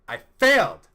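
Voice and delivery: sudden loud cry of disbelief at failing; loud outburst. Real, not acted. Telling friends the project failed, a loud outburst. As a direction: blurt it out loud and high.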